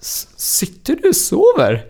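high, astonished question; surprised doctor. Retold or imitated. Doctor, astonished the patient sleeps sitting, asks in a high voice. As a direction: sound amazed he sleeps sitting up.

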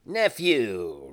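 loud, firm falling rebuke; stern. A narrator's reading. Uncle rebukes his pleading nephew, stern. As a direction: cut him off sternly, loudly. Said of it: "returned the uncle sternly".

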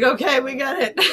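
words broken up with laughter; laughing. Retold or imitated. Mom laughing at her son roaring nonstop, laughing through it. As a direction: say it while cracking up.

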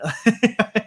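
a quick high laugh at herself; short amused laugh. Real, not acted. Host laughing about missing his accent, short high laugh. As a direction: laugh off the mix-up. Laughing at her own surprise.

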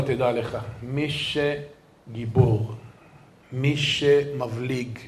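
low, soft, very slow pronouncement; hushed and drawn-out, each phrase weighed; solemn counsel. Retold or imitated. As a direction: imitate a rabbi giving weighty advice. Rabbi's solemn maxim, acted.

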